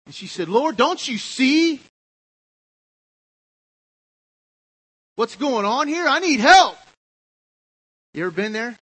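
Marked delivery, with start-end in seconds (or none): 0.5-6.8 high, loud, slow exasperated plea; frustrated Martha. Acted. Preacher voicing Martha complaining to the Lord. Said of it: "She got really angry".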